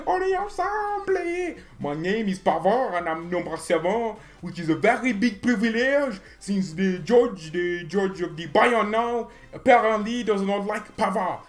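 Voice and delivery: put-on high, loud French-accented player voice; a mock French footballer speaking English in a French accent; French accent impression. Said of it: "Benjamin Pavard".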